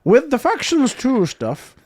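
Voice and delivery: high, slow exaggerated Irish accent. Shown as a demonstration. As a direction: stretch it out in a hammy Irish accent. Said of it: "Do the Irish accent".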